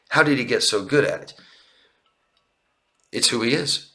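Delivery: ordinary delivery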